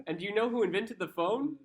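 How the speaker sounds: high, loud leading question; mock-triumphant challenge. Retelling an argument with a partner, triumphant mock challenge. Retold argument, pressing the point.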